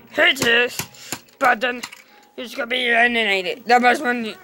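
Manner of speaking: ordinary talk, nothing marked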